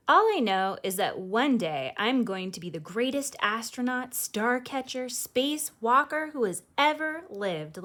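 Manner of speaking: put-on lively read-aloud voice for children; storybook reading. A narrator's reading. Host reading a picture book to kids, lively storytelling. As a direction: read it like bedtime story time.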